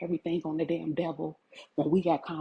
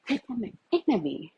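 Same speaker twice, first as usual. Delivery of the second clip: ordinary talk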